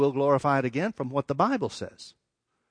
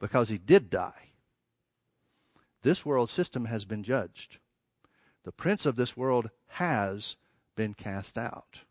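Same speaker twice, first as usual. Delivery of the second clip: low, slow and soft; solemn hushed resolution; 5 semitones lower, 6 dB quieter, slower than usual. Real, not acted. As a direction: let each phrase settle with weighty pauses. Solemn resolution after the build.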